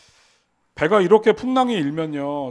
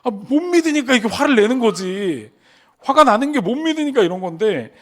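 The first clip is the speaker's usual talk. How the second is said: higher, louder chiding voice of the Lord; Jesus chiding; 7 semitones higher, 5 dB louder than usual. Retold or imitated. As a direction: scold them as the Lord would, higher and louder. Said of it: "주님께서". (제자들을 나무라는 주님의 높고 큰 목소리)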